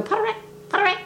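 high guinea-hen chatter; imitating a guinea fowl's cackling call. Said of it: "They go".

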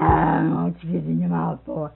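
loud, low mock bellow; sudden imitated roar of reproach; retold scolding. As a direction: bark it at a child as if imitating. Acts out Jim scolding child at table.

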